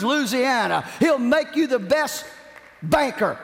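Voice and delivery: high-pitched, forceful sermon delivery; impassioned preaching. Real, not acted. Preacher urging congregation to depend on God, impassioned high preaching. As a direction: preach it with soaring pitch, driving each point home.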